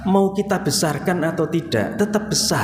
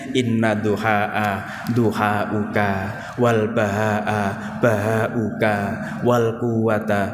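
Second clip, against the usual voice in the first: slow, low chanted Arabic prayer; prayer recitation; 8 semitones lower, much slower than usual. Acted. Preacher reciting the Duha prayer to the congregation, slow and low. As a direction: recite it slowly and reverently.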